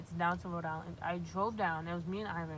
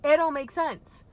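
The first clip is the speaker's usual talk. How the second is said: louder, higher, slow and fed up; higher, louder, slower exasperated protest; exasperated outburst; 7 semitones higher, 11 dB louder, slower than usual. Real, not acted. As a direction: spell it out slowly, fed up. Venting about unfixed car.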